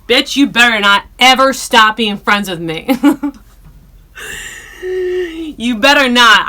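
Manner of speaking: loud, hammed-up threat, laughing through it; mock threat. Telling friend Lena never to stop being friends, loud playful mock threat. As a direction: threaten her playfully, slow and loud on "You better not".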